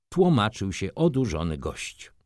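ordinary delivery, nothing marked

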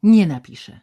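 loud, defiant refusal; stubborn resistance with a loud attack; frantic defiance. A narrator's reading. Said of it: "odrzekła w szaleństwie oporu".

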